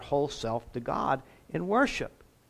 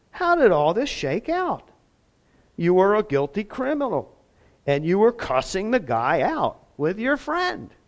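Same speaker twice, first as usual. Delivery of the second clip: incredulous wonder; 5 semitones higher, 4 dB louder than usual. Retold or imitated. Begg's imagined words to the thief.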